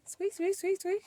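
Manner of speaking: high squeaky squeak noises; playful squeaky machine sound; imitating a squeak. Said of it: "You hear my machine? It has a squeak".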